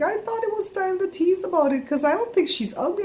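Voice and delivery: high-pitched, re-enacted excuse; retold placating explanation. Explaining the teasing to upset Jada, high and placating.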